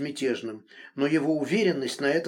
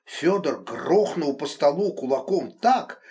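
usual speaking voice

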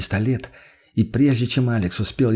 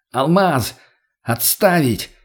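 shouting; 4 semitones higher, slower than usual. Acted. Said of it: "Как можно громче крикнул".

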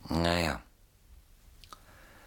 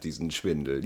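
ordinary talk, nothing marked

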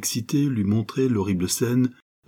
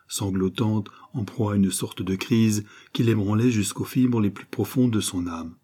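ordinary delivery

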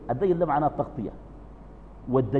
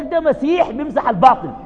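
emphatic quote; 10 semitones higher, 6 dB louder than usual. Retold or imitated. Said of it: "قارنه، قال لك".

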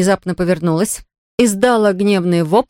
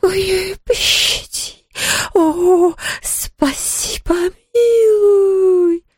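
sobbing ghost voice; 11 semitones higher, much slower than usual. Acted. Ghost begs after egg hit.

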